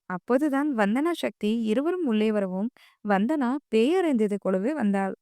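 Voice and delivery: ordinary delivery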